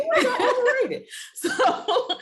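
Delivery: can't stop laughing while talking. Real, not acted. Laughing at own story.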